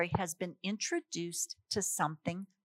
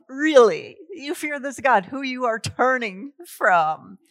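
incredulous; 5 semitones higher, 10 dB louder than usual. Acted. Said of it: "you're thinking to yourself".

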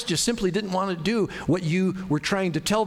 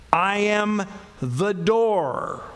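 drawn out very slowly, each word weighed; very slow emphasis; 3 semitones higher, much slower than usual. Acted. Pastor stresses Jesus's words to the congregation, solemn and slow. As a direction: lean hard on "door", slow and solemn. Quoting verse nine very slowly.

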